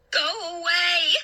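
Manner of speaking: high, drawn-out cry of horror; frightened cry. A narrator's reading. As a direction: ward it off with a long cry. Narrator screams at attacking bats.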